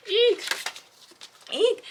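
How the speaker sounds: two short, high-pitched eeks; excited squeal. Real, not acted. Reader thrilled by a romance blurb, excited squeal. Squealing over a cute book.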